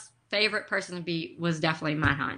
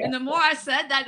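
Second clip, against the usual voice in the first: usual speaking voice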